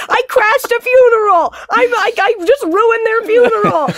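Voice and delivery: high, loud, mock-hysterical wail; hammed-up distraught outburst; retold panicked confession. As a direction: re-enact a frantic confession to the boss.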